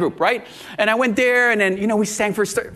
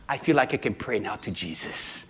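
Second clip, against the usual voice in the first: low, soft retold confession; hushed sincerity; 10 semitones lower, 8 dB quieter than usual. Woman finally able to pray to Jesus, hushed. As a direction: drop low and speak softly.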